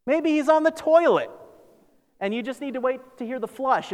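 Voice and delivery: high-pitched mock helpfulness; sarcastic taunt. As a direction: needle them with a silly explanation. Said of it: "He's being sarcastic here".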